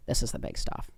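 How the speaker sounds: low, soft, near-whispered retelling; hushed, weighty imitation of a friend; grave hush. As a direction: drop right down to deliver the serious news. Friend gravely breaks the news.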